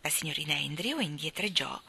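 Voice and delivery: ordinary delivery, nothing marked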